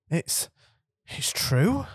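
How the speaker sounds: halting, rising in disbelief; faltering. A narrator's reading. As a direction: barely dare ask. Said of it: "faltered Professor McGonagall".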